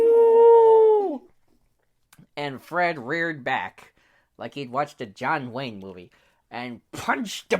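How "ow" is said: very high, loud, long cartoon yowl; wolf's pained howl. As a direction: yowl like a wolf stabbed in the paw. Wolf stabbed in the paw.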